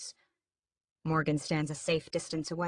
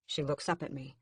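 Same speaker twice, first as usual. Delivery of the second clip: ordinary delivery, nothing marked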